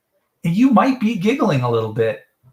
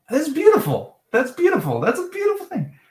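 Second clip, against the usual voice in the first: high, soaring "beautiful"; mock admiration; 10 semitones higher than usual. Real, not acted. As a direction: gush over it playfully.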